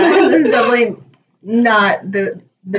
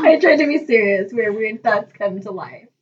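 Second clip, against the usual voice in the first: ordinary talk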